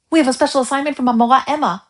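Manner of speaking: put-on fast, high and loud eager kid; excited child. A narrator's reading. Boy telling grandmother about school, fast and excited. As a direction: rattle it off excitedly, high and quick.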